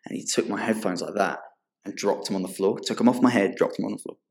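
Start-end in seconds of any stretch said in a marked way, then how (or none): none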